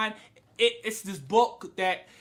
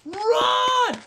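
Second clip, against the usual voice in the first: high, loud warning yell; urgent high-pitched shout, hammed up; yelling at the screen; 13 semitones higher, 5 dB louder, much slower than usual. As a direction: scream for him to flee. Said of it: "I'm yelling and screaming".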